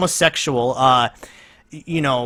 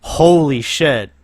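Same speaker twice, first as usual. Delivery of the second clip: usual speaking voice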